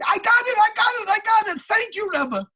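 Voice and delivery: high, loud burst of excitement; very excited. Retold or imitated. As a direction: exclaim it with thrilled relief. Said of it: "he got very excited".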